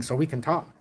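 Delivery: soft, low-pitched, keeping it down; hushed low voice. Real, not acted. Talking softly while his son sleeps, hushed low voice. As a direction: talk low so nobody wakes. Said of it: "I have to talk like this".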